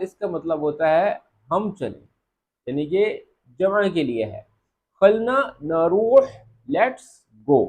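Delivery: ordinary talk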